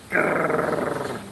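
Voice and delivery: imitated rolling gator growl; alligator growl. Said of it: "[name] is pretending to be an alligator. He's going".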